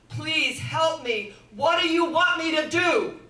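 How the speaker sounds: loud, slow, pleading cry for help; desperate plea shouted into the dark. Retold or imitated. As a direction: beg out loud from the dream, not real sobbing. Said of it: "she begins to sob, and she begins to cry out".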